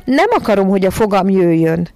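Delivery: put-on a child's cross refusal; angry yell. A narrator's reading. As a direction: shout it in a temper. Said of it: "Ordított rá dühösen John".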